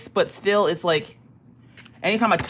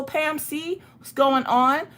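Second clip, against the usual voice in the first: ordinary talk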